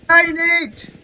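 loud, high shout to a teammate; shouted urging. Real, not acted. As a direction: yell at them to fight back.

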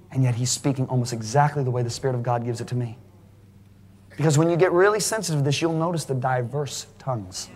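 ordinary delivery

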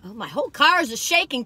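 normal talking voice

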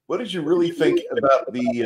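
ordinary delivery

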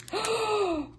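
high, sudden gasp of surprise; sharp gasp. Real, not acted. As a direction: catch your breath at a surprise. Discovers special drill in kit.

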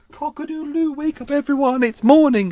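be a smug rooster waking everyone; cockerel voice. Retold or imitated. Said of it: "You're literally just going".

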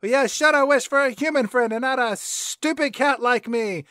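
hammed-up cat character; Grumpy Cat. Acts out Grumpy Cat mocking wish.

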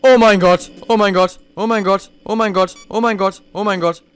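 real panic calming down; panicked repeating, fading. Real, not acted. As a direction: gasp it out over and over. Panic as monster near.

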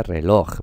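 reloj stressed on LOJ; stress on final syllable. Shown as a demonstration. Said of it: "Se pronuncia reloj".

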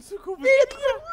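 high crying scream; screaming while caught; panicking gamer. Real, not acted. Caught by the monster. (панический крик)

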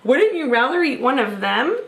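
ordinary delivery, nothing marked

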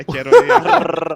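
excited. Real, not acted. Teammate caught by devil.

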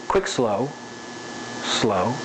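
short "quick", then lingering low "slow"; spaced beats, each "slow" drawn out; rhythmic phrasing. Shown as a demonstration. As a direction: drop pitch and ease off on "slow". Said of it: "this move can be quick".

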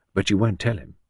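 ordinary delivery, nothing marked

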